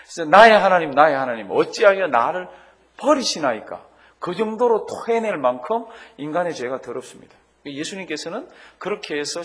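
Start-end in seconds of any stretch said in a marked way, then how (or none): 0.3-3.8 loud cry that fades by the end; preacher voicing Christ's cry again; anguished cry. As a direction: cry out, then let it sink. Preacher re-voices cry from cross.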